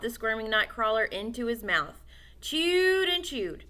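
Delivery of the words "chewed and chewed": ordinary delivery, nothing marked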